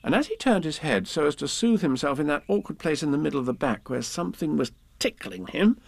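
plain reading voice

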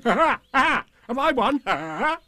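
very high, laughing tiger triumph; gleeful bouncy tiger; cheerful. A narrator's reading. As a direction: burst out merrily, laughing before and after. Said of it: "said cheerfully".

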